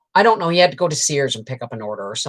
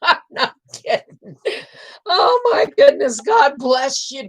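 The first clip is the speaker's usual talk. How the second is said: high, teary laugh; half crying, half laughing; laughing through tears; 11 semitones higher, 4 dB louder than usual. Real, not acted. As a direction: break into tearful laughter. Laughing-crying about late dad.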